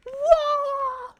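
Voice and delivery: high, loud Mario-style falling wail; Mario fall cry. Retold or imitated. Streamer imitating Mario falling, high loud wail. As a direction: do Mario dropping off a cliff. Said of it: "like when Mario falls off a cliff".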